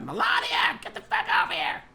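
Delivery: shrill high yell across the house; bellowing for his wife to come over; mock yell. Trump yelling for his wife.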